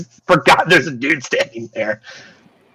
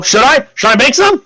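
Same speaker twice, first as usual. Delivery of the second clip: eager guard; 12 semitones higher, 5 dB louder than usual. Acted. Guard eagerly offers to cut himself.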